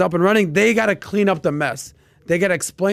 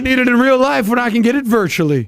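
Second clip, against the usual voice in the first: mock voice; 8 semitones higher, 6 dB louder than usual. Mocking partner's excuse.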